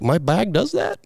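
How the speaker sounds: high, amazed question; surprised. Retold or imitated. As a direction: squeak it out in disbelief. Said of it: "And I was like".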